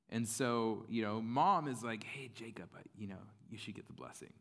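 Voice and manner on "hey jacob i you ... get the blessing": hushed scheming mother; conspiratorial whisper. Retold or imitated. Mom plots with Jacob, conspiratorial whisper. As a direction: whisper it secretly to your son.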